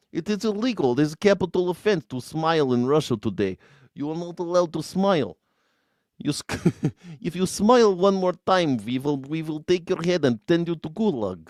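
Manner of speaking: Vladimir Putin. Acted. Streamer as leader banning smiles in Russia, mock-menacing Russian accent. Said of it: "When I do my Vladimir Putin accent".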